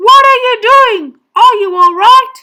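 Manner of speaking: high, very loud questions; worried yell. A narrator's reading. Boy calling to man in the pool, alarmed shouting. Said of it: "I yelled".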